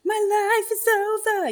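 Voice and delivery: high, loud, slow mock teenage wail; mocking her own teen-pregnancy panic, high and loud. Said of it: "this real kind of particular script".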